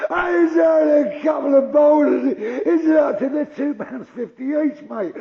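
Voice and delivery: high, slow, loud scornful echo; outraged mimicry; mocking shout. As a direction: throw his words back at him. Man mocks calm reassurance.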